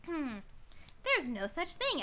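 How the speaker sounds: ordinary talk, nothing marked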